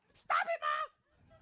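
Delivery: very high, drawn-out whine; mock whiny shriek. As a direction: shriek at his mom, stretching the words slowly. Yelling at mom on phone.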